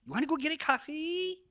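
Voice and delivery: squeaky nervous ask for a coffee; high eager ask. Shown as a demonstration. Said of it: "You notice my voice inflection?".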